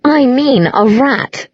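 high, slow, stretched words; drawn-out tipsy girl; tipsy insistence. A narrator's reading. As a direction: spell it out drunkenly.

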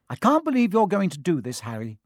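high, loud reproach, falling off on the name; exasperated telling-off that starts high; disapproving scolding. Acted. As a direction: scold in indignant disbelief. Hermione scolds from the chair.

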